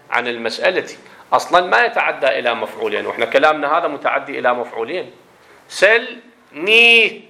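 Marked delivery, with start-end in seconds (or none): 5.7-7.2 "سلني" very slow and split, high and loud; broken into parts. Shown as a demonstration. Teacher splitting the verb from its pronouns, "سلني" slow and segmented. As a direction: pull "سلني" apart piece by piece, stretched out. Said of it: "الياء المفعول الأول والهاء".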